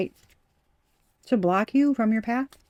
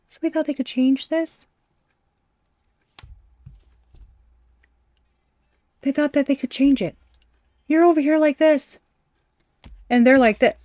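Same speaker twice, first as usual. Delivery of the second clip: excited; 6 semitones higher, 5 dB louder than usual. Real, not acted. Excited reading, high and loud.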